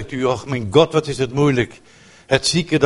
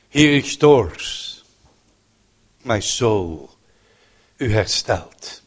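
slow reverent quote; 4 semitones lower, much slower than usual. Real, not acted. Slow quoted psalm line.

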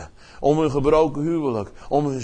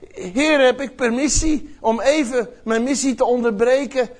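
earnest plea to the Father, raised voice; pleading Jesus; 7 semitones higher than usual. Retold or imitated. Jesus asks his Father whether he may interrupt his mission, a pleading higher voice. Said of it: "dat Jezus zegt".

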